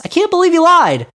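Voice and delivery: deadpan-turned-squeal of fake shock; sarcastic surprise. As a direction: gasp it out in mock astonishment. Sarcastic reaction to DeSantis claim.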